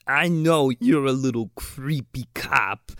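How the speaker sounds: slow, put-on movie villain voice; villain impression. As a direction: imitate a bad guy taking his time. Acts out the villain's line.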